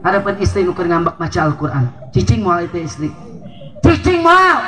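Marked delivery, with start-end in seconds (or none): 3.8-4.6 emphatic shout. Acted. Preacher shouting the punchline to the congregation, emphatic shout. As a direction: shout it high and loud, hammering moal.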